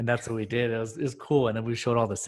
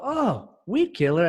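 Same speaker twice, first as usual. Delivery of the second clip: high, slow commercial gasp of joy; delighted discovery; 10 semitones higher, 3 dB louder, slower than usual. Retold or imitated. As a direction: act amazed like an infomercial. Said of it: "I'm like".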